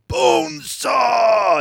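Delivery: slow, gravel-free wrestler shout; Macho Man voice. Retold or imitated. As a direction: stretch it out like a wrestling promo. Imitating Macho Man announcing Bonesaw.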